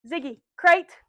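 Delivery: loud, firm call to the dog; loud dog command. Real, not acted.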